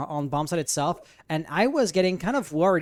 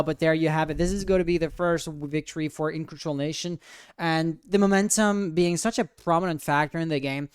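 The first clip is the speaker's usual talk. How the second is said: ordinary delivery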